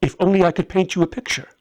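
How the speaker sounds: put-on excited pleading to his old friend; excited, fast. Melchior eager to convince.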